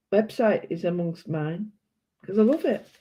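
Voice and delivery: ordinary delivery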